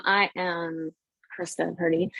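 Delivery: normal talking voice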